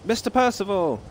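put-on high call in an English accent; great shout, English accent. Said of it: "Not in an English accent, though".

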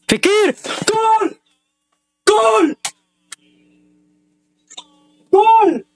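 high, screaming goal celebration; goal shout. Real, not acted. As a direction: yell goal at the top of your lungs. Live goal for his team.

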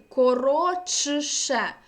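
коротше very slow and loud, as корочше; ch-sh assimilation. Shown as a demonstration. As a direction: draw out коротше with чш for тш. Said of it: "«чиши»". (дуже повільно коротше, як корочше)

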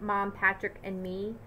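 ordinary delivery

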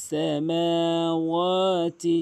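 slow, melodic recitation; Quran recitation. Shown as a demonstration. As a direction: chant it slowly, drawing out each vowel.

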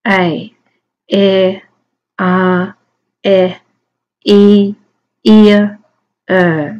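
isolated vowel sounds, a pause after each; "A, A, R, E, E, ear, er" as separate phonemes; sounding out vowels. Shown as a demonstration. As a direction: recite each vowel sound on its own, held evenly. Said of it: "Twenty vowels".

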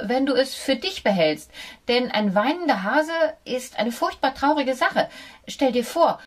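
hohe Mäusestimme, lauter und entschlossener; mutige Maus. A narrator's reading. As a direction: fasse Mut und sprich hoch und bestimmt. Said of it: "sagte die Maus jetzt schon recht mutig". (die Maus redet dem Hund mutig ins Gewissen)

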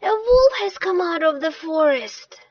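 high, loud announcement of danger; alarmed shout. Acted. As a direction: shout it to the whole village. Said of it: "he shouted".

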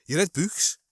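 put-on Scouse accent with "books" drawn to "oo"; Scouse grandparent. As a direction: ask it like an older Scouser. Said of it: "my grandparents, um, were Scousers who".

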